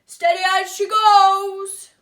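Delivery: loud, slow captain's boom. Acted. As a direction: boom it out from the helm. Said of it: "Grandad boomed".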